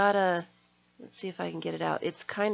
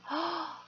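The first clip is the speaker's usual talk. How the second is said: gasp; 9 semitones higher, 5 dB quieter than usual. Real, not acted. Sees tag.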